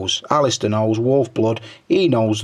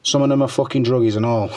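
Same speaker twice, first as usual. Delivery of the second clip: usual speaking voice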